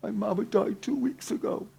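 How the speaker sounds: high, soft voice of someone starting to cry; imitated tearful daughter. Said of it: "And she starts crying".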